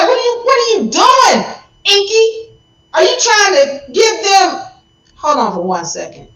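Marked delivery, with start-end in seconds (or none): 0.0-4.6 much higher, louder furious yell; Enlil going off. Retold or imitated. Enlil explodes at Enki, much higher and louder. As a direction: blow up at your brother. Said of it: "Enlil went off".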